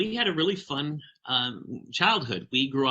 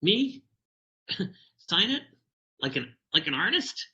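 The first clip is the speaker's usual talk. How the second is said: put-on high, disbelieving little boy; shocked and surprised; 7 semitones higher than usual. Boy asked to sign his squiggle, high stunned disbelief. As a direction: squeak it out as if he can't believe it. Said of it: "a little bit shocked, a little surprised".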